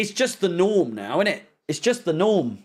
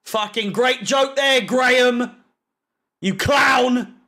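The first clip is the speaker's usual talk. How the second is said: raised, higher-pitched scornful jeer; angry sarcasm; 4 semitones higher, 3 dB louder than usual. Real, not acted. Fan berating Graham over his joke, angry sarcasm. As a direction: throw the insult out at full voice.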